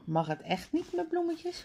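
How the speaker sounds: high, soft questioning voice. A narrator's reading. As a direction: ask it softly, pitched well up. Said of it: "vraagt Suzanne op straat". (zachte, hoge vraag)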